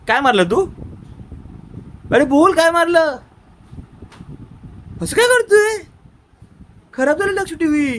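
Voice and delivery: loud, high-pitched telling-off; scolding. Real, not acted. Scolds child over broken TV. (मोठ्या, चढ्या आवाजात रागावणे)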